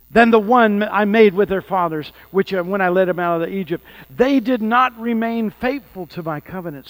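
loud, ringing reading of the Bible; fervent scripture reading. Acted. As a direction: proclaim the verses with fire. Reads scripture with force.

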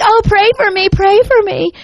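high, whiny mock pleading. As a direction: imitate kids making fun of tearful prayer. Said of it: "should probably really do it for you".

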